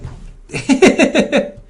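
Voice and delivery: high breathy burst of laughter. Real, not acted. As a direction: laugh out in disbelief. Laughs after outcry.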